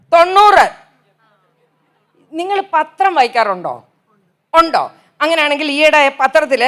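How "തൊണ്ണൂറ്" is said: outraged exclaim. Real, not acted. Outraged retort of ninety.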